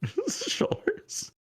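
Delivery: laughing. Real, not acted. Laughing correction mid-scene.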